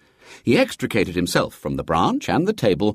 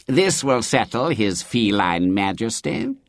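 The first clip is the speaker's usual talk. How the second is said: a hint of a smug curate; smiling vicar. A narrator's reading. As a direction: say it with a smirk. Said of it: "he smiled".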